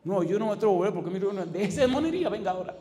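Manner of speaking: lightly voiced excuse, quick and high; a hint of a nervous backslider; hesitant sinner. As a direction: mimic a reluctant churchgoer's excuse. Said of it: "La gente se queda rumiando".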